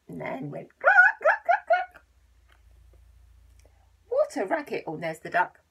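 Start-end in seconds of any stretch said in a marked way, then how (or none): 1.2-2.0 very high loud clucking; hen clucks. Acted. Reading the hen's clucks to children, high loud clucks.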